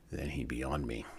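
ordinary delivery

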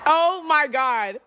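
laughing in disbelief; slow amazed then laugh. Real, not acted. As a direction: savor the moment, then burst out laughing. Laughing at [name]'s Grammys.

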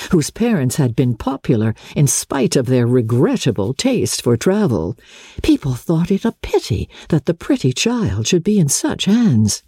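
ordinary delivery, nothing marked

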